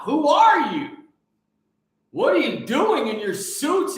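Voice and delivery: sudden angry shout. Real, not acted. Ranting at leaders, an angry shout. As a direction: yell it at the leaders.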